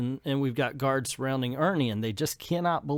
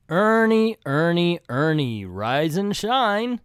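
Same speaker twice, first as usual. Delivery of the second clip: raised, drawn-out singsong call; coaxing acted wake-up; 4 semitones higher, 4 dB louder, slower than usual. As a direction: call the name, then stretch the ending out high. Voicing someone waking Ernie.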